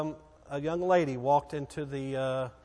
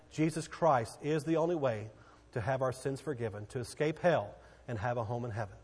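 usual speaking voice

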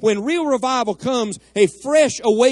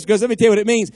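usual speaking voice

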